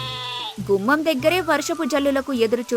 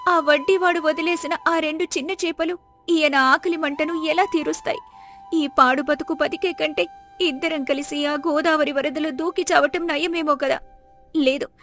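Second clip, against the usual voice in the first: higher-pitched, anguished woman's voice; grieving poor wife; 4 semitones higher than usual. Acted. Wife pleading with God over her suffering husband, grieving. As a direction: lament to God on a high, sustained pitch.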